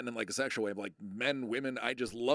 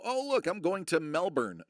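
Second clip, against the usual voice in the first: higher, louder naive American, Melbourne with a hard R; mock Yank tourist; 6 semitones higher, 5 dB louder than usual. Streamer mocks Yanks reading the map, naive tourist voice. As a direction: mimic an excited Yank, pitched up and loud. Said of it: "all of you Yanks".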